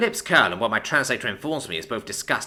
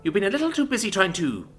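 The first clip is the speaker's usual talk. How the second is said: higher, tight-lipped and bitter; 9 semitones higher than usual. A narrator's reading. Commander tells the envoy there is no truce symbol, tight-lipped. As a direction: bite back the anger. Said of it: "Her lips tighten as she says".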